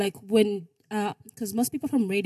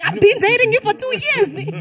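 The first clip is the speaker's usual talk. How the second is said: mock girlfriend; 13 semitones higher, 5 dB louder than usual. Mock girlfriend's indignant reply.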